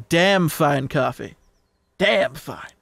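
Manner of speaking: slow savouring. Acted. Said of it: "savouring coffee line, slow".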